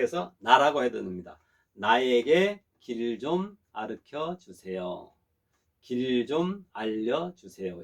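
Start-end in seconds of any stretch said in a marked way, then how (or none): 1.7-7.8 slow, deliberate phrasing; slow model sentence. Shown as a demonstration. Teacher demonstrates the casual request to the class, slowly. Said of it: "이렇게 할 수가 있습니다".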